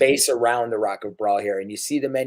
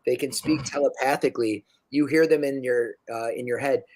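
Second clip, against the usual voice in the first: ordinary talk, nothing marked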